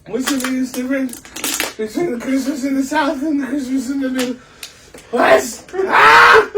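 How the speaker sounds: slow, high, strained, breaking into a yell; pained shout. Real, not acted. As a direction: fight through the burn and cry out. Asking question while mouth burns.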